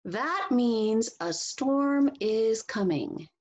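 slow, sing-song storybook reading; reading to children. Acted. Teacher reading a picture book to the class, slow and expressive. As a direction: read it slowly and expressively for young listeners.

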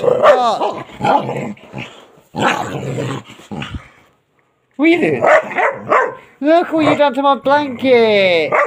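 high, sing-song scolding of the dog; high dog-talk voice. Real, not acted. Owner mock-scolding the dog over the blanket, high pet voice.

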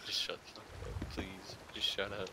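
fast mock whisper. Player telling the bell ringer to shut up in a fast whisper. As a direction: hiss it fast under your breath.

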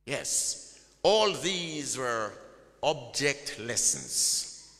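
normal talking voice

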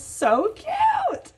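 high delighted squeal; sudden high-pitched squeal of delight; squealing so cute. Real, not acted. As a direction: gush over the card like a fan. Delighted at the photocard.

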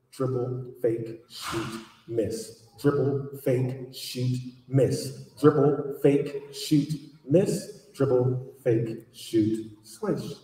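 word by word with short pauses; rhythmic repetition. Acted. Author acting out basketball practice, rhythmic and measured. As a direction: count out a drill in a steady, separated beat.

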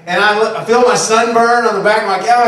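usual speaking voice

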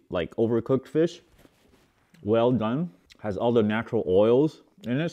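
chewing while talking; mouth partly full. Real, not acted. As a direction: between spoonfuls of fish. Seen 0: Took a bite at -1.0, chewing; spoons another bite at 3.0.

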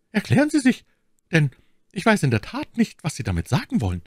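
high-pitched lady demanding explanation; Milady, uneasy. A narrator's reading. Milady demands that the young man explain, uneasy. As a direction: press him in a high, uneasy voice.

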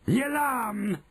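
high, drawn-out witch screech; slow, piercingly high witch cry; shrieking Grand High Witch. A narrator's reading. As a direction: shriek it in triumph, stretching the words. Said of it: "shrieked the Grand High Witch".

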